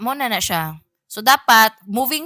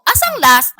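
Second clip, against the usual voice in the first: loud, demanding call to the line; loud announcing; 5 semitones higher, 7 dB louder than usual. Retold or imitated. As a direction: announce it loudly to the queue. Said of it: "Mo-announce jud ko pag abot nako".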